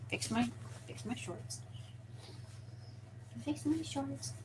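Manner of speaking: barely audible, very soft aside; muttering to self. Real, not acted. Creator adjusting shorts off to the side, near-silent muttering. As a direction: murmur it under your breath.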